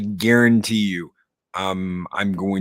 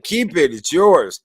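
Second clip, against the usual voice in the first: much higher, loud scoffing Wolfman Jack voice; 8 semitones higher, 8 dB louder, slower than usual. Retold or imitated. Retelling the Roadhouse scene, loud mock DJ voice. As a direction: scoff at the valet idea like the old DJ. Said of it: "And he's like".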